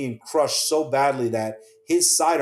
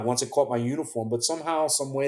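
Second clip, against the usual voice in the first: usual speaking voice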